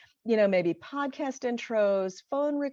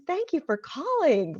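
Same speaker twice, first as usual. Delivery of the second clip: high, cheery smiling phone greeting; upbeat, welcoming receptionist greeting, pitched up; smile in the voice; 7 semitones higher than usual. Shown as a demonstration. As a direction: answer the phone through a big smile. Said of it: "answer with a smile in your voice".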